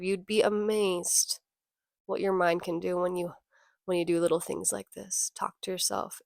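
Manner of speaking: soft encouragement. Real, not acted. Soft encouraging self-talk advice.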